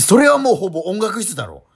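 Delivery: loud, sharp comeback; exasperated retort. Real, not acted. Friend scolding her nonsense, loud exasperated retort. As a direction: snap back at the absurd answer. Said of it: "ツッコミ。キレてるね。".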